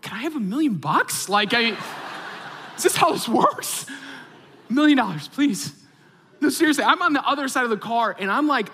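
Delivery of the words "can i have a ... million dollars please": high-pitched, cheeky request; mock eager pleading. Dad jokingly asking God for money, mock eager pleading. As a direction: try your luck, voice pitched up. Jokily testing prayer.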